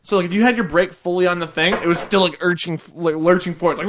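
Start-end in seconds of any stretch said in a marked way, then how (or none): none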